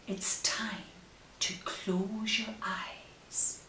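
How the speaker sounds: ordinary delivery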